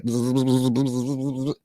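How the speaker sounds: low quiet buzzing; bee buzzing. Retold or imitated. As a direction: hum like a bee swarm. Imitating buzzing bees.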